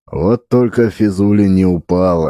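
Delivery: ordinary delivery, nothing marked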